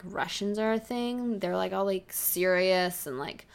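ordinary talk, nothing marked

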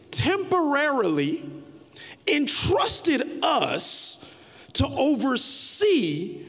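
slow preaching shout; preaching emphasis. Real, not acted. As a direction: drive the words home slowly.

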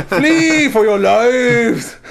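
put-on high, very slow German-accented cry; mock German alarm. Host warns the German food line, mock German accent cry. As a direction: drag out a hammed-up warning in a fake German accent.

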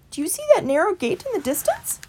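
brisk, high little mouse; helpful mouse pointing across the field. A narrator's reading.

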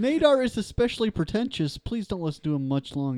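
high, loud yelling plea. Acted. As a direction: yell it while being shoved. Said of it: "I'm yelling as".

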